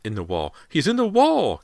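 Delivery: very high, loud scream. A narrator's reading. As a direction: scream it out in terror. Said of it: "Callie's mother screamed".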